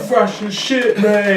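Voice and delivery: high, mocking kid voice for the insult; high mocking imitation of a rude kid. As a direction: sneer it out like a cheeky kid. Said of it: "he was just like".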